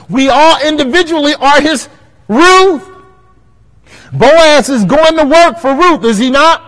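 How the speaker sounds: high, loud preacher's cry; preaching at full force. Acted. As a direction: call it out to the church.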